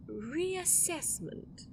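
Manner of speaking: very slow, stretched word; slow, menacing stretch; drawn-out threat. Acted. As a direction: let the threat hang. Villain threatens contract.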